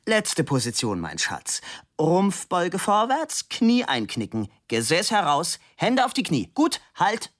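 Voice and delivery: high-pitched pose commands; commanding sculptor, pitch jumping high. A narrator's reading. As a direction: order the model into position. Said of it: "Man hörte den Baron kommandieren".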